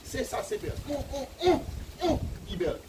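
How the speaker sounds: high, loud, hammed-up admiration of a pretty woman; mock-excited man calling a woman beautiful; excited admiring man. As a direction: gush over her like a smitten man.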